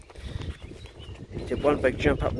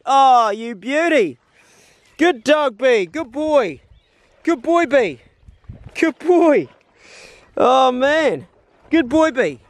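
much higher, louder sing-song praise for the dog; 11 semitones higher, 6 dB louder, much slower than usual. Real, not acted. Hunter praising his dog for the retrieve, high excited praise voice. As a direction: gush over the dog in a high happy voice. Dog brought rabbit back.

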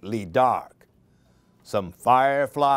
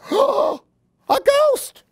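much higher, louder squeal; frightened cry; 6 dB louder than usual. Acted. Baby Chick crying out at the ghost. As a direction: shriek it like a terrified chick. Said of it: "cried Baby Chick".

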